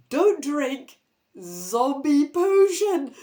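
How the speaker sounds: loud, slow stern warning; warning the siblings off the potion; warning note. Acted. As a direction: read the note like a big warning. Reading warning note aloud.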